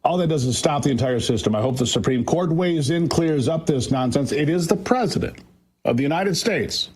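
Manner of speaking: usual speaking voice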